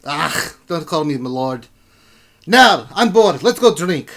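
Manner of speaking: loud, high dwarf king, impatient; boisterous dwarf ready for a drink; bored dwarf king. Acted. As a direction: wave off the formality as a dwarf ruler.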